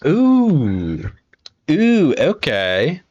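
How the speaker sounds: high, slow, loud interested ooh; excited, eager interest; intrigued ooh. Real, not acted. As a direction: draw out the ooh with delight. Reacting to stuffed burgers.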